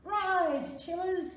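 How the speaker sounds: loud, high command; cried out. A narrator's reading. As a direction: urge the kids to flee. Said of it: "cried Granddude".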